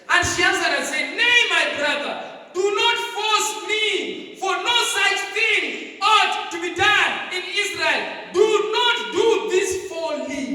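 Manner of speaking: high, loud, slow declamation; impassioned pulpit cry, drawn out; full-force scripture reading. A narrator's reading. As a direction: proclaim each phrase like a preacher. Reads scripture aloud at full force.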